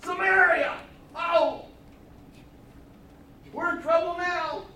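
alarmed soldiers realizing where they are; exclamation. Acted. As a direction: cry it out as if suddenly trapped. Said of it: "with an exclamation point".

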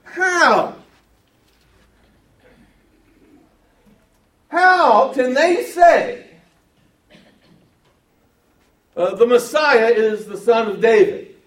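"How?" cried out high and loud, then "How can they say" slow and loud; preacher demonstrating how the question is read; stress on how. Shown as a demonstration. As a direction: hammer the word "How" with a big loud rise. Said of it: "listen to how it's read".

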